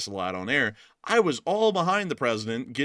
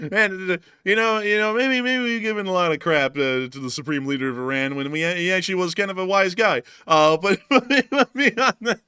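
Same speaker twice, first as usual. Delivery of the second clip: high, loud laughter through words; talking through real laughter; laughing hard; 7 semitones higher, 8 dB louder than usual. Real, not acted. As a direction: crack up while talking.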